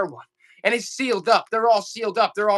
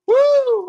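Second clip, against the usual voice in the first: high, loud whoop of relief; relieved whoop; 7 dB louder than usual. Real, not acted. Seller relieved after Bradley buys the lot, loud high whoop. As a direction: let out a big whoop. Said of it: "I was running out of breath there".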